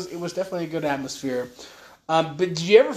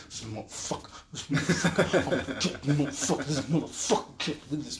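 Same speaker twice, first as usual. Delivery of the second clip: muttering stranger; 6 dB quieter than usual. Retold or imitated. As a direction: mumble it fast under your breath. Stomping stranger muttering to himself.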